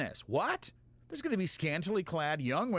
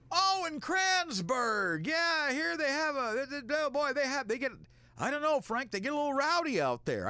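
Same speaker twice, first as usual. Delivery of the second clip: put-on folksy old colleague; 9 semitones higher than usual. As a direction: hem and haw like a cheerful old-timer. Old colleague Jeff quoted.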